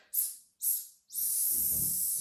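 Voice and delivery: long unvoiced snake hiss. Shown as a demonstration. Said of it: "make that s".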